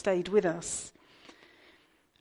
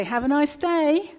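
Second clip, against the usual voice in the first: lightly acted, drawn-out, higher shop sing-song; shop assistant's farewell; 6 semitones higher, 5 dB louder than usual. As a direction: mimic a routine cheery shop goodbye, slowly. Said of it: "hear someone in the shop say".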